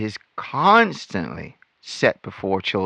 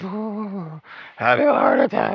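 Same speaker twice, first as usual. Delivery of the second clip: mock alarm; 8 semitones higher than usual. Mocking the witnesses' overreaction.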